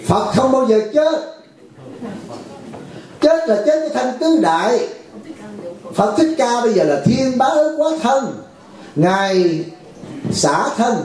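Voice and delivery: high, slow, ringing sermon voice; fervent preaching. Acted. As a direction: proclaim it to the hall. Preaching at full force.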